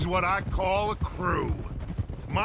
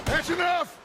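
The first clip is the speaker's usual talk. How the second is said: high, firm raised voice; commanding call; 7 semitones higher than usual. Acted. Gang leader to holed-up guard, calling out sharply. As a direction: call out to end the argument.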